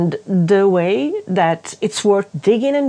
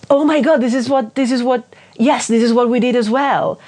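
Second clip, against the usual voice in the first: excited realisation; 6 semitones higher than usual. Retold or imitated. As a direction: exclaim it like you just found your own childhood in a book. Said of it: "I was like".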